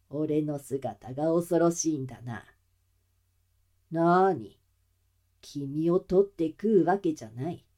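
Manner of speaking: low, muffled, mocking villain. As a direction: soothe with sinister calm. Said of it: "その声が聞こえてくるのです".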